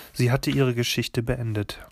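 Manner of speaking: ordinary delivery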